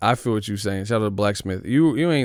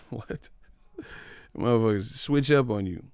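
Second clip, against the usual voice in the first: ordinary talk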